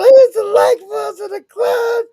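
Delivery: high, loud, drawn-out whoop of recognition; excited outburst. Real, not acted. Co-host cheering a remembered childhood clown show, excited outburst. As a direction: cry it out with gleeful enthusiasm.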